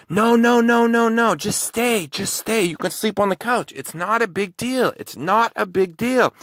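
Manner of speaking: hammed-up needy man begging not to go; pleading creep. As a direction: whine at him to stay, high and pleading. Clingy drunk man begging him stay.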